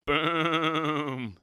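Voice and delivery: shouted boom, a little high; playful boom; mock thunder boom. A narrator's reading. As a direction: imitate a thunderclap. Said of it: "said Goat".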